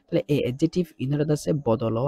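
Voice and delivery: usual speaking voice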